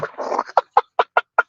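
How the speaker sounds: soft, airy laugh without voice; wheezing chuckle at your own bleak line; quiet breathless laughter; real laugh. Real, not acted.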